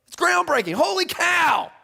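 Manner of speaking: exclaiming holy cow; mock amazement. Real, not acted. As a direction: gasp it out like big news.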